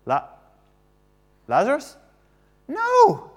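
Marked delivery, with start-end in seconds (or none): 2.7-3.3 a sharp high "No."; teasing high no; high playful no. Real, not acted. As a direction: bounce the No up high. Correcting child.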